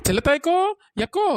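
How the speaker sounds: loud call with a rising end; hailing a neighbour across the yard. Acted. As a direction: call her name from a distance. Calling out from afar.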